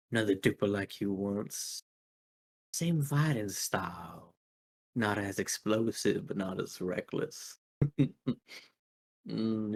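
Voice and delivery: calm, menacing veteran fighter, quiet; Vamp. Acted. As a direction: Vamp musing to Woodchuck, soft and menacing. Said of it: "and Vamp says".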